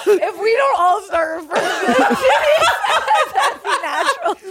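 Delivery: loud laughing exclamation; laughing shout. Real, not acted.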